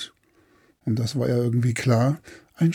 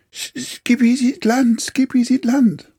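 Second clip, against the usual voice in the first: high, shrill monkey call, much higher; 11 semitones higher, 4 dB louder than usual. Acted. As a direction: shout it from the lookout like a monkey. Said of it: "der Affe laut rief". (laut rufende Affenstimme)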